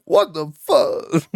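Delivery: high, slow, strained gasp of shock; Idris Elba choking. Retold or imitated. Imitating a choking Idris Elba meme, high and slow. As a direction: choke the words out, stunned. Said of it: "He's like".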